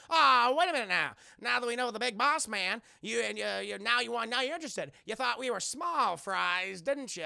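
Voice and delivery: hammed-up mock-gangster indignation; gangster confrontation. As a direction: needle him like a cartoon crime boss. Lil Capone confronts Jack.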